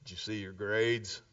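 a low, grave father's question; stern dad. Retold or imitated. Dad confronting his daughter about her grades, low and grave. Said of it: "He goes".

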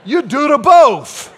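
slow, loud, high Cajun punchline; Cajun accent. Acted. Preacher echoing waitress to congregation, emphatic Cajun accent.

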